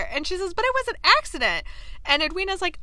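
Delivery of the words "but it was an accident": high, quick defensive protest; acted squeal of innocence. Kate defending herself to Anthony, protesting.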